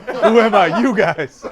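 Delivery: loud, high, through real laughter; laughing taunt. Real, not acted. Game master teasing players after impersonation, laughing loudly. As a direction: crack up while shouting the taunt.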